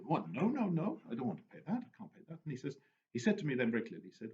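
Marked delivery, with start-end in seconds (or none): 0.0-2.4 high, pretend-shocked refusal; feigned outrage, playing dumb; mock protest. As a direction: act startled at the fine. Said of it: "and then you say".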